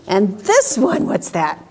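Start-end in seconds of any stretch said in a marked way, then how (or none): none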